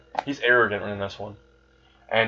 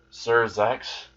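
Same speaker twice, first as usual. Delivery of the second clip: ordinary delivery, nothing marked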